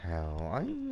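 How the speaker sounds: sudden high squeaky pretend-energy; mock energetic. Streamer faking energy to prove she's not tired. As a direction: fake being wide awake. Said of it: "perfectly energetic and awake".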